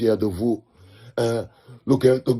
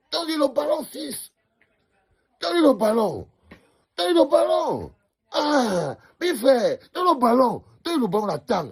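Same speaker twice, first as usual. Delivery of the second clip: much higher, begging cry to pass the ball; high pleading shout; 13 semitones higher than usual. Retold or imitated. Fan yelling at the player to pass, high pleading shout.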